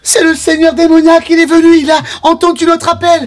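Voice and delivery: high, excited proclaiming; awed announcement. Acted. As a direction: announce him with awe. NPC announces the demon lord. (annonce exaltée et aiguë)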